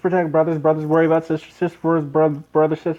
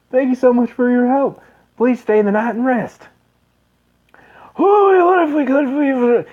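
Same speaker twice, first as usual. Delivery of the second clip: higher, louder friendly thanks; grateful villager; 8 semitones higher, 6 dB louder than usual. Acted. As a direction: thank the hero warmly.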